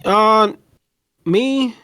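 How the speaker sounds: normal talking voice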